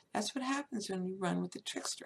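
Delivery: ordinary talk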